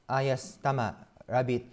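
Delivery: ordinary talk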